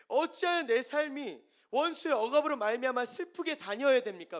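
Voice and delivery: high, loud, anguished preaching voice; impassioned preaching. Acted. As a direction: cry it out from the pulpit. Preaching at full force. (절규하듯 높고 크게 외치는 설교)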